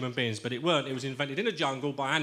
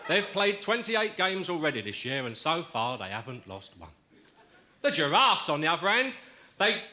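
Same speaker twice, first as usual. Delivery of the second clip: sports commentator; 3 semitones higher, slower than usual. Acted. Football commentary verse delivery.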